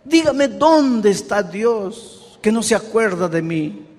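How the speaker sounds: imitating a desperate churchgoer; desperate woman pleading. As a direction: beg like a woman who feels forgotten by God. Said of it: "una señora desesperada me decía".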